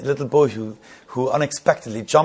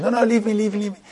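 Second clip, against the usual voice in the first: much higher, brushing the apology aside; insistent wave-off; 9 semitones higher, faster than usual. Retold or imitated. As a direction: leave me, eagerly dismissive. Minister waves away the apology.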